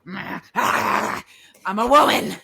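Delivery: loud, high frustrated retort; exasperated. Retold or imitated. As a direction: push back, fed up and loud. Retold reaction to advice.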